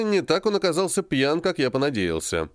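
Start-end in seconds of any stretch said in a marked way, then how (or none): none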